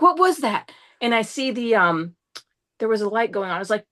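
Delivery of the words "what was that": higher-pitched startled question; retold freak-out. Driver startled by a dashboard chime, retold alarm. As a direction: re-enact alarm at the noise. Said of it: "'Cause I'm freaking out".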